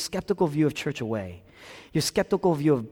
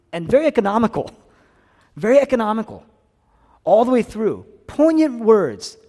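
loud, high-pitched preacher's urgency; impassioned preaching; 10 semitones higher, 10 dB louder than usual. Real, not acted. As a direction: preach it at full force, voice raised. Preaches at full force.

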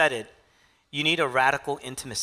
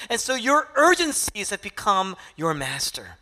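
higher and louder, pressing, heartfelt appeal; impassioned plea; 7 semitones higher, 5 dB louder than usual. Acted. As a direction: preach it as Jesus pleading with Martha, full force. Preacher voicing Jesus again.

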